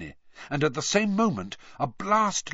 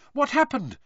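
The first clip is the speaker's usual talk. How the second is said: much higher, anxious question; 14 semitones higher than usual. A narrator's reading. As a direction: call out worried in the dark. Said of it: "said Hermione anxiously".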